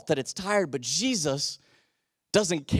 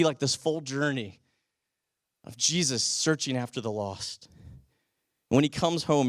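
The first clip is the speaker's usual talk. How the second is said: ordinary delivery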